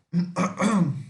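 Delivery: clearing a catching throat. Real, not acted. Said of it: "minha voz falhou".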